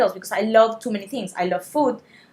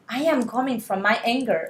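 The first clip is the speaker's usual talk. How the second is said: ordinary talk, nothing marked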